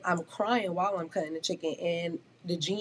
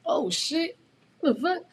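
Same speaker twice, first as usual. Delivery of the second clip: surprised onlookers; 10 semitones higher, slower than usual. Retold or imitated. Customers peeking, reacting.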